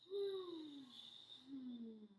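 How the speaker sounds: lazy falling sigh. Shown as a demonstration. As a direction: sigh it out, slack and easy. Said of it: "start with a sigh".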